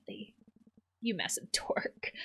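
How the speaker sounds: whispered, laughing at a friend; teasing whisper. Acted. Whispering to a friend about his late-night messages, teasing. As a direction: hush it out like a fond insult.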